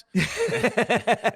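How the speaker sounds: a loud, high burst of laughter; big laugh. Real, not acted. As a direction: laugh out hard at your own joke. Laughs at own Steelers joke.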